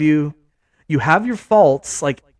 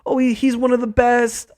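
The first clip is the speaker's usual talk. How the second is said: higher, mock-swooning praise; gushing fans; 8 semitones higher than usual. Mocking fans raving about Cooper Kupp, high swooning gush. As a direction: gush like an overexcited fan. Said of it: "and then Cooper Kupp".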